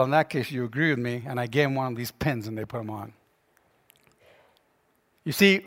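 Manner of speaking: normal talking voice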